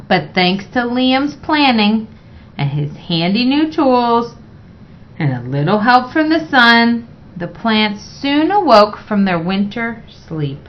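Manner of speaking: ordinary delivery, nothing marked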